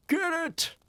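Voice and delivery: high, loud sharp command; shouting. A narrator's reading. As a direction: bark it out in a frenzy. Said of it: "they were shouting".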